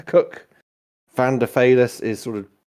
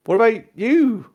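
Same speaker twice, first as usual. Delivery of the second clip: surgeon turning a question on someone, high and loud; high, swooping question in character; halfling surgeon; 13 semitones higher, 7 dB louder than usual. Acted. Game master plays the surgeon.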